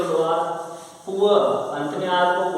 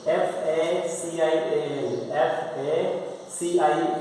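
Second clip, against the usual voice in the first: slow spelled-out letters; much slower than usual. Shown as a demonstration. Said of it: "special बोलेंगे".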